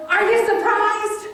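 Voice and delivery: loud, high, pleading rant; frustrated, mad. Acted. Woman crying out to God about unanswered prayers, frustrated. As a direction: hurl your hurt and disappointment upward, insistently. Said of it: "I'm disappointed, I'm frustrated".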